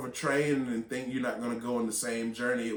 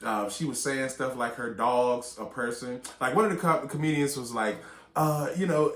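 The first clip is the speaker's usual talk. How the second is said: ordinary talk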